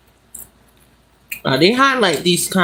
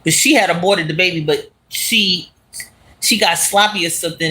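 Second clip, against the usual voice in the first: ordinary talk, nothing marked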